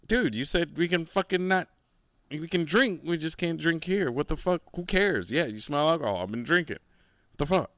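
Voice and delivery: indignant retort. Retold or imitated. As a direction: throw his own rule back at him.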